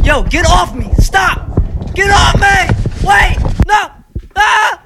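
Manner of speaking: high, loud frantic shouting; panicked yelling. Acted. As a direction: yell to be let go. Grabbed, struggling to break free.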